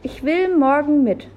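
high, loud stubborn demand; defiant little boy. A narrator's reading. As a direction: insist like a defiant child. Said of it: "machte sein trotzigstes Gesicht".

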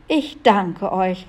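usual speaking voice

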